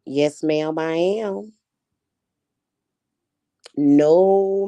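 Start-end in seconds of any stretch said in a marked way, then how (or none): none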